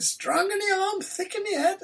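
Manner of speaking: high, laughing Cornish farmer voice; mock Cornish farmer. Retelling the hitchhiking driver's line, mock Cornish accent. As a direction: ham up the big Cornishman's boast. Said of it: "He said".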